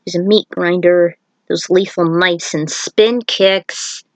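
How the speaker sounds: ordinary delivery, nothing marked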